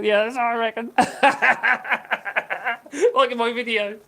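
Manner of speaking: can't stop giggling at the viewer's comment; laughing. Real, not acted. Vlogger laughing at a viewer's joke about Francois, giggling. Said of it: "that did make me tickle".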